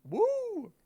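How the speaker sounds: high excited cheer; yelled woo. Pretend. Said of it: "Jamie will yell woo a bunch".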